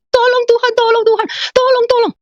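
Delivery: high-pitched re-enacted wailing; crying plea. Re-enacting herself begging God for help, crying out. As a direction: cry out desperately for help, high. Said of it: "Saya menangis".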